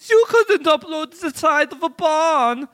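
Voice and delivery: put-on high, loud German accent. Kim Dot Com answering the hackers in a German accent. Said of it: "a German accent".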